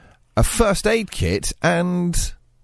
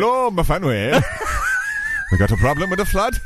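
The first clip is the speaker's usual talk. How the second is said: lightly put-on Welsh accent; sing-song Welsh crew member. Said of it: "do an impression of one of the crew members".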